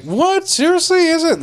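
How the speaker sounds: very high squealing disbelief; stunned disbelief. Retold or imitated. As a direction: squeak it out, can't believe it. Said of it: "I was just like".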